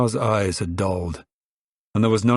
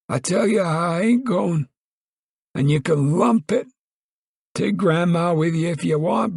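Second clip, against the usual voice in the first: Grandpa cried out; 11 semitones higher than usual. Acted. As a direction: shout it high like a stubborn old coot. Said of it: "Grandpa cried".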